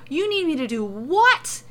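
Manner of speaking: loud, high wail of disbelief; aghast, theatrical outcry. A narrator's reading. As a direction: wail the question in alarm. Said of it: "he wails".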